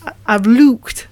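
slow, careful learner's Geordie; attempted Geordie accent. Shown as a demonstration. Actor trying out a coach's practice sentence, shaky Geordie accent. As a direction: try Geordie, drawing out each key vowel. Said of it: "then we had to say".

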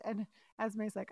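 usual speaking voice

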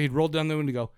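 ordinary talk

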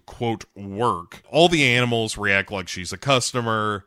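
normal talking voice

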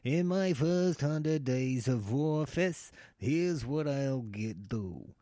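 slow, pompous politician speech. Retold or imitated. A politician promising voters, slow and pompous. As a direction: stretch every word like a campaign promise. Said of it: "I don't know why they talk like that".

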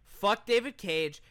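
ordinary delivery

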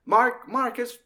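high, fumbling recognition; Mannix recognising. Retold or imitated. Mannix fumbling Warren's name, high surprised. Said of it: "And he's like".

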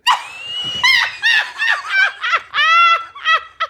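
shrieking high laugh. Real, not acted. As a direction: explode into a shrill laugh. Bursts out at review line.

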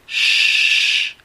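a loud "Shh", like hushing someone; shushing sound. Shown as a demonstration. Teacher comparing sh to hushing someone, loud shush. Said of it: "como cuando quieres callar a alguien".

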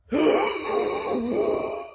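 sharp high gasping inhale; startled revival gasp; gasp for breath. Acted. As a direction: suck in air like coming back to life. Dead man revives gasping.

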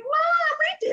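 put-on high-pitched, child-like announcement; excited call to mom. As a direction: imitate proudly calling out to mom. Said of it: "you're like".

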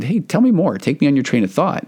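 lifted, eager invitation to open up; bright inviting prompt. Retold or imitated. Therapist inviting the wife to share, in a lifted encouraging voice. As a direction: coax her gently to keep talking.

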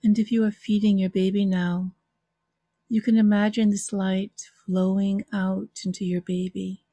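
calm, unhurried meditation guide with long pauses; guided visualization. Acted. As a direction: ease listeners into calm relaxation.

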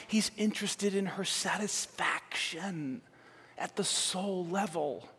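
soft, slow and intimate; quiet sincere preacher's hush; tender and hushed. Acted. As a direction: lean in and say it gently. Tender turn after the fiery lines.

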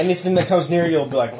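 usual speaking voice

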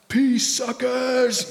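high, slow, sneering "Peace, suckers"; mock snarky farewell. Pastor imitating himself mocking drivers from his truck. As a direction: stretch it out, mocking the other drivers. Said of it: "but I'm like".

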